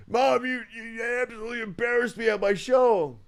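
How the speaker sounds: high, loud outraged whine; mock angry son. Imagined phone call to mom after the show, mock angry. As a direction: play an embarrassed son yelling at his mother.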